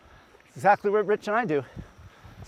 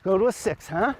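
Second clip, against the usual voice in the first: ordinary talk, nothing marked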